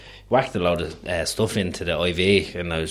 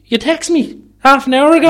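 impatient mother; 9 dB louder than usual. Retold or imitated. Mother answers groggy son.

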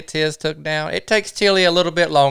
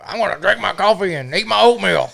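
mocking put-on voice, pitched up; Chad; 4 semitones higher than usual. Camper mocking his dawdling friend at breakfast, higher put-on voice. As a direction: imitate a stubborn buddy who won't hurry. Mocking Chad's stubborn reply.

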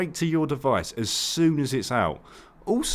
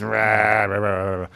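mock garbled name; 5 semitones lower, slower than usual. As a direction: trail into nonsense growled syllables. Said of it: "I reckon a lot of people do".

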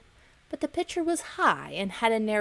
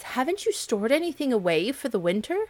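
scolding ants; 7 semitones higher, 6 dB louder than usual. Acted.

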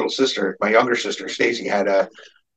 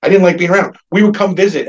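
ordinary talk

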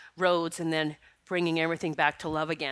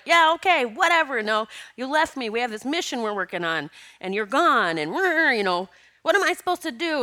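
high, louder mock yell at a friend who left; half-hearted scolding, pitched up and louder; retold yelling; 6 semitones higher, 4 dB louder than usual. Retelling yelling at late friend Lynn, louder mock yell. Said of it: "I started yelling at her".

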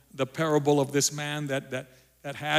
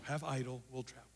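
quiet repeat; 13 dB quieter than usual. Real, not acted. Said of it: "That was Rachel's motto".

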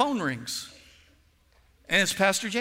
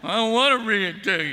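put-on resist like a sulky kid, louder; acted-out reluctant refusal; mock reluctance; 3 semitones higher, 5 dB louder than usual. Acting out his reluctance to read.

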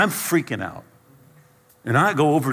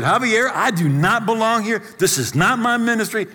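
higher and louder, desperate; panicked plea; 10 semitones higher, 5 dB louder than usual. Retold or imitated. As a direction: plead to get out. Panicking, begging his friend.

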